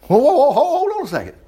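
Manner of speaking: high, hammed-up halting protest; mock alarm. Preacher reacts to the claim of equality, mock alarm. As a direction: call a sudden stop in playful shock.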